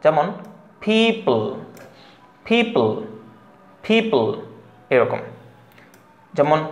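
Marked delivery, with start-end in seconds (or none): 0.8-4.2 পিপল with a puffed first p and plain second p; high, isolated পিপল, first p breathed out like ph; aspirated first p. Shown as a demonstration. Teacher modelling English for learners, পিপল with aspirated first p. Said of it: "তাহলে প্রথমটা ফ".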